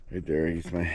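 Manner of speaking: ordinary delivery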